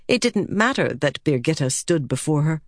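ordinary delivery

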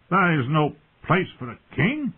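high-pitched, affronted proclamation; haughty declaration. A narrator's reading. Brother refuses the lowly seat, declaring it loftily. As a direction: declare it high and self-important. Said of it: "her brother declared".

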